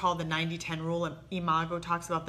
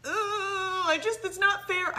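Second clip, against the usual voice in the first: frustrated lament; 12 semitones higher, 3 dB louder, slower than usual. Retold or imitated. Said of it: "So when I feel that".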